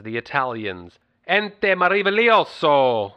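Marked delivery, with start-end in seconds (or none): 1.3-3.1 loud, high, drawn-out Italian announcement; slow, booming mock-Italian fanfare; hammed-up Italian flourish. Host announces our friends the Italians, hammed-up Italian flourish.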